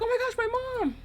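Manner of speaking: high-pitched, re-enacted outburst of relief; excited relief. Girl spotting mom at the corner, excited relief. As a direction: cry out as if suddenly rescued. Relief at mom's miraculous arrival.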